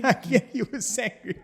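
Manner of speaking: high, giggling confirmation; real amused laughter; laughing. Real, not acted. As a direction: laugh through the answer. Laughs confirming the angry call.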